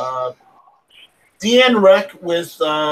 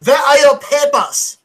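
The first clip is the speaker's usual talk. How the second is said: high, louder German officer; foreign guard voice; 8 semitones higher, 5 dB louder than usual. Pretend. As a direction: interrogate like a border guard. Mock checkpoint guard demand.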